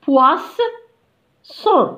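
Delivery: "poisson" slowly, with a gap before "son"; "poisson" as two separate slow syllables; split into syllables. Shown as a demonstration. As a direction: break "poisson" in two, pausing after the s sound. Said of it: "قالوا لنا encadrer les syllabes".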